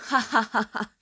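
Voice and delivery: hammed-up scoffing laugh; mock laughter. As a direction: laugh in their face, stagily. Acted scoffing laugh of Jesus' opponents.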